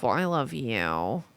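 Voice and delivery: soft fond reply to a viewer; tender. Real, not acted. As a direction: say it fondly and gently.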